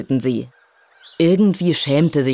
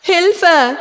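high, loud distant call for help; far-off muffled plea for help; cry for help from below; 5 dB louder than usual. A narrator's reading. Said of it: "Hilferufe nach oben".

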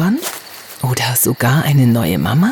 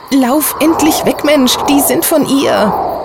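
elf screaming; 12 semitones higher than usual. Acted. Abby urges Hannah to flee the seed cloud, higher urgent mild yell. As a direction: urge her to run in panic. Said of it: "schrie Abby".

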